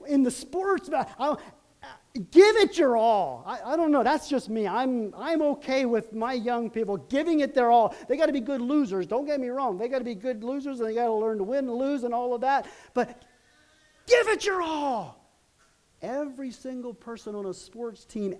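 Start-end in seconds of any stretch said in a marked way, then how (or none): 2.3-3.4 rally the team with a loud push; loud urging. Real, not acted. Urging players to give everything. 14.1-15.2 a slow high plea to the team; high drawn-out plea. Real, not acted.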